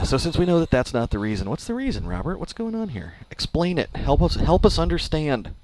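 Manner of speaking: high, pleading pretend outrage; mock exasperated demand. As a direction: press the manager for an answer, hammed up.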